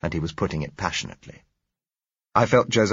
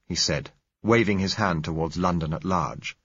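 plain reading voice

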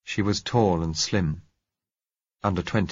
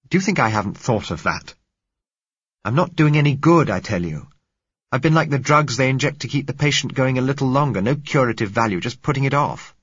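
louder and higher, exasperated insistence; heated protest; 6 semitones higher, 6 dB louder than usual. Acted. Man snapping back at the girl who begs him stay, heated and louder. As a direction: push back hard, frustrated and defensive. Narrator argues, defending leaving.

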